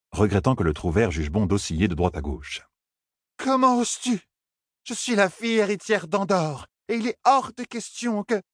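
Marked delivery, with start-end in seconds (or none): 3.4-8.4 high, haughty indignation; outraged Elaine. Acted. Elaine slapped by Tom, high and outraged. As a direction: draw herself up as heiress.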